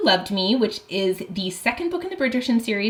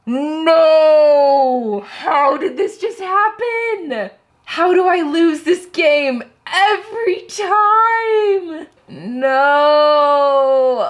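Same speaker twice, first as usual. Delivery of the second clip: loud, high drawn-out wail of defeat; slow despairing shouts; real dismayed wailing; anguished cry; 6 semitones higher, 6 dB louder, much slower than usual. Real, not acted. Lost the game again.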